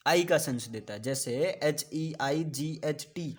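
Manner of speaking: ordinary delivery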